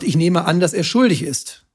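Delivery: ordinary talk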